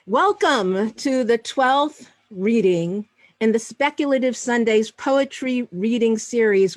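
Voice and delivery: measured, projected announcer delivery; formal host introduction. Real, not acted. Host welcoming the audience to a poetry reading, formal and projected. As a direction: open the event with ceremonial emphasis.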